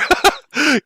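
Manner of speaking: high-pitched burst of laughter. Real, not acted. Streamer joking about forbidden language, high laugh. Laughs at banned term joke.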